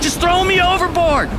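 high, loud yell; shouted instruction. Acted. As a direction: shout it over the storm. Jonah shouting in the storm.

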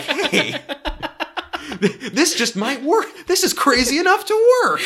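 high, loud, laughing excitement; excited dudes. Retold or imitated. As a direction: squeal it like giddy guys. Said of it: "And they were like".

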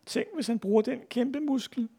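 soft, high anxious near-whisper; hushed worry. A narrator's reading. Big boy fears Ivan's giant muscle, high hushed voice. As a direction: murmur it high and small, in awe. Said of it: "hviskede en af de store drenge".

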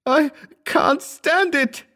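very high, drawn-out wail; slow, high cry of agony; agonized shriek. A narrator's reading. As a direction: shriek it in unbearable pain. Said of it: "he shrieked".

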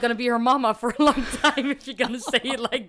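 loud, high, laughing through it; laughing retort. Retold or imitated.

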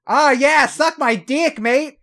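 high, loud mock Boston accent drifting Aussie. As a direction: ham up a Boston accent in dirty talk. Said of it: "between a Bostonian and, like, a Chicagoan".

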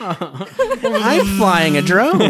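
high, slow line broken by laughter; laughing. Acted. Cohost joking about filming with a drone, laughing. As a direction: squeeze it out through giggles.

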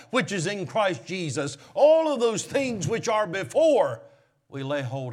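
loud, high full-force preaching; pulpit-pounding sermon voice; fiery preaching. Acted. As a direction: preach it out to the whole church.

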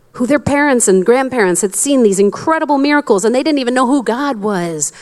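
normal talking voice

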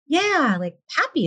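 high, bright yeah; mock cheerful yeah. Guest imitates upbeat group members, high cheerful yeah. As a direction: chirp yeah like a happy member. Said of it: "some of them are like".

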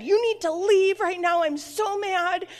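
high-pitched mock outburst of temper; angry yell. Mom ordering her son out after losing her temper, angry yell. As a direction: re-enact an exasperated, high-voiced telling-off. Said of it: "I yelled at him".

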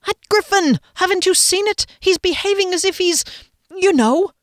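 very high, loud, worked-up hinting; exasperated insistence. A narrator's reading. Friend pressing the obvious, exasperated insistence. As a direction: spell it out in high agitation.

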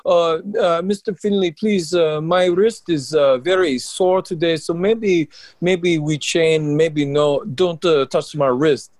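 hammed-up French wrestler begging in a French accent. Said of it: "in his French accent".

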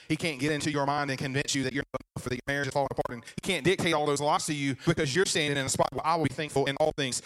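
ordinary talk, nothing marked

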